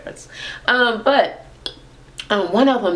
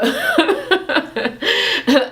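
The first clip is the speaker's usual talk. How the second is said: high laugh breaking into the story; 12 semitones higher than usual. Real, not acted. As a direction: burst into a high-pitched laugh. Laughs during the retelling.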